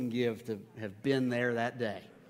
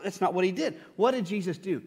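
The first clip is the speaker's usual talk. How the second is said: normal talking voice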